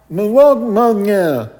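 high, loud imitation of a deaf man's speech with blurred words; imitating deaf speech. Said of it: "but he was deaf".

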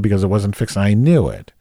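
ordinary delivery

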